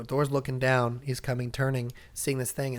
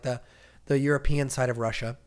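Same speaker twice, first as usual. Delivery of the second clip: usual speaking voice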